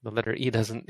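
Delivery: ordinary talk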